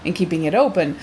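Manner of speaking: ordinary talk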